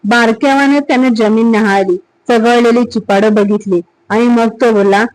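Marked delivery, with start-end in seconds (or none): none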